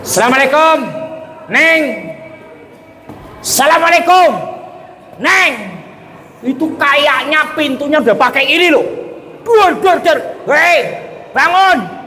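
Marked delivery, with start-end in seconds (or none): 0.1-5.8 raised, high calling voice; husband calling his wife at the door. Acted. 9.4-12.1 high shout with door bangs; impatient husband yelling at a sleeping wife; shouting to wake her. Acted.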